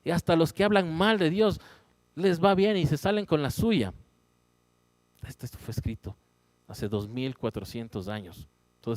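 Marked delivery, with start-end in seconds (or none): 0.0-3.9 indignant complaint. Retold or imitated.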